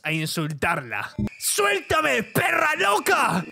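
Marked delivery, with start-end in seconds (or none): 1.4-3.4 angry shout. Acted. Insult choice, shouted.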